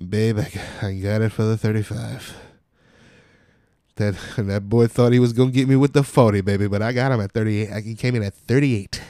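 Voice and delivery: loud, triumphant customer impression; bragging customer. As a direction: boast to your partner, building to a hammered finish. Imitates customer bragging after the call.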